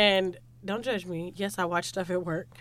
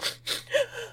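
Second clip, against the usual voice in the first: high mock sobbing gasp; tearful gasp; 13 semitones higher, 4 dB quieter than usual. Friend re-enacts tearing up at work, high weepy gasp. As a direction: gasp as if bursting into tears.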